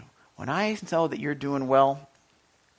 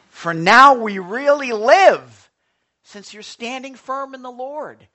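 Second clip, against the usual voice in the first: preaching verse; 6 semitones higher, 14 dB louder, slower than usual. Acted. Pastor reads Paul's verse aloud.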